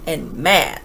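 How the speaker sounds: plain reading voice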